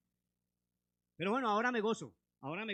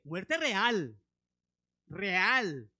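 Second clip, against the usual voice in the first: "real" punched louder; stress on real; 3 semitones lower, 7 dB louder, much slower than usual. Real, not acted. Preacher stresses real death, louder. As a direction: land "real" heavily twice. Emphatic repetition.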